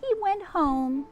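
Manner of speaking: bright storybook read-aloud; reading to children. A narrator's reading. Reading Peter Rabbit aloud to a child, storybook voice. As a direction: tell it like a bedtime story for a child.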